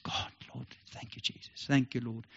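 very soft, almost whispered thanks to God; hushed prayer. Real, not acted. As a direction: murmur thanks privately to Jesus. Breaks into private prayer mid-talk.

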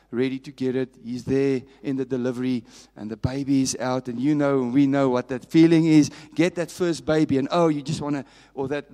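ordinary talk, nothing marked

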